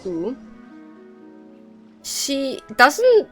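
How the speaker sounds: ordinary delivery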